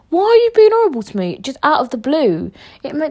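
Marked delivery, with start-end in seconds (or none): 0.1-1.4 very high, fast mock-hurt quote; retold plaintive protest. Podcaster asks why he was horrible, high plaintive protest. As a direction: whine the question quickly, pitched way up.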